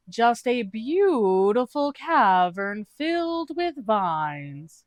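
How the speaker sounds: high, very slow, overly innocent; a bad liar stalling; Doog's voice. Doog lies to Ao, high and drawn out.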